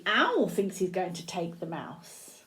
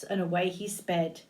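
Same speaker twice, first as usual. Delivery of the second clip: ordinary delivery, nothing marked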